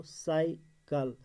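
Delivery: Cycle slowly, sai, pause, kal; split into syllables. Shown as a demonstration. Teacher showing the word has two syllables, split into syllables. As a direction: break cycle into two drawn-out beats. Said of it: "cycle में दो".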